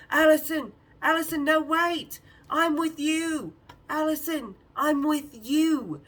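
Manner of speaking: high, loud pleading call, slowed; imitating him yelling after her across the pool. Glenn calls after Allison across the pool, loud pleading.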